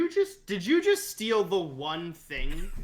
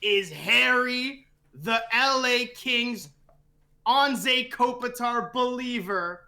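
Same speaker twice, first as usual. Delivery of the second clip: loud, higher, slow grand announcer voice; ring announcer intro; 5 semitones higher, 4 dB louder than usual. Pretend. Cohost giving a guest a proper grand intro, booming announcer. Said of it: "I have to actually give him a proper intro".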